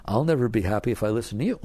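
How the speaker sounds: ordinary delivery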